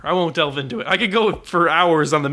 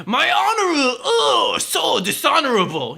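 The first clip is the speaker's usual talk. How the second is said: mock samurai honor speech; 10 semitones higher than usual. Mocking a cliché movie samurai, hammed-up. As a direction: overact a cartoonish Japanese warrior lamenting dishonor. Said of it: "they're not about".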